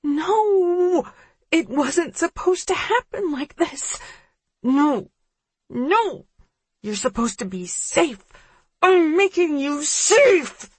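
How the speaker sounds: high, slow anguished scream. A narrator's reading. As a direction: scream in broken despair. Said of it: "she screams".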